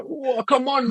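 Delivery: ordinary talk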